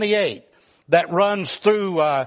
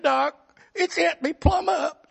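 whiny patient; 9 semitones higher than usual. Retold or imitated. Arzy whining to doctor.

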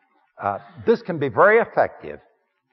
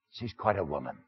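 softer, a knowing aside; confiding; 8 semitones lower, 9 dB quieter than usual. Acted. Man confiding to the congregation about his woman, softly. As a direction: lean in and confide it quietly.

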